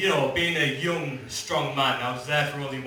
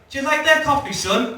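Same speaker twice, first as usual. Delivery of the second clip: higher, louder mock mum asking; mum's voice; 10 semitones higher, 5 dB louder than usual. Comedian voicing his mum asking about coffee, higher and louder. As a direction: lightly voice an eager mum. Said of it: "my mum was like".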